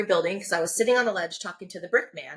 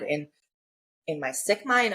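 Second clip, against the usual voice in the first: ordinary delivery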